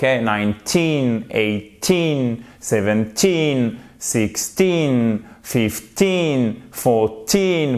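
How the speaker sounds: put-on slow countdown with a long falling "teen" in each number; stress on TEEN. Shown as a demonstration. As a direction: draw out the end from "Nineteen" to "fourteen". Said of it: "c'est la dernière syllabe, le teen, qui est accentué". (accent appuyé sur chaque « teen » final)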